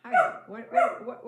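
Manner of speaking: sudden loud high startle; startled. Real, not acted. Startled cry as something interrupts her vlog. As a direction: jump at a surprise.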